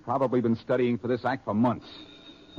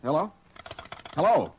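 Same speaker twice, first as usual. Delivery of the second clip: rising calls; 8 semitones higher, 4 dB louder than usual. Acted. Caller hung up, calling louder.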